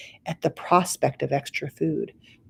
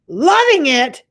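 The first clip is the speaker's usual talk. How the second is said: high, loud outraged giant; yell; 9 semitones higher, 8 dB louder, slower than usual. Acted. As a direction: spit the words back. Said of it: "yelled the blood bottler".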